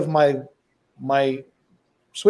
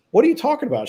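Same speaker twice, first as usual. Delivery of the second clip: baffled question; 11 semitones higher, much faster than usual. Retold or imitated. Confused dad asks daughter.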